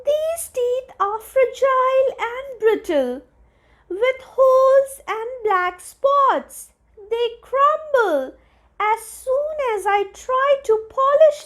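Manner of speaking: high fretting fairy voice throughout; a small fairy puzzling over crumbling teeth; fairy Incissor worried. Acted. Incissor worries to the other fairies, high fairy voice. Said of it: "said Incissor".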